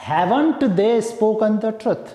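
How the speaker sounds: "hasn't" said slowly and clearly; slow careful demo. Shown as a demonstration. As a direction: dictate word by word. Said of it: "contraction form में बोलूंगा तो कैसे बोलूंगा". (धीमे, साफ़ उच्चारण)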